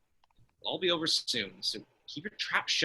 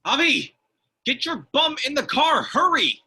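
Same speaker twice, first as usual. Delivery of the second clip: high, loud panicked yell; urgent shout; 11 semitones higher, 7 dB louder than usual. Acted. Driver yelling at friend to jump in, panicked shout. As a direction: scream at him to move now. Fleeing the fight, calling out.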